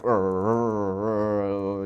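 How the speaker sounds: little dog growls, voice slightly changed; imitated dog growl. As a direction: growl it out like a small angry chihuahua. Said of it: "And the chihuahua's like".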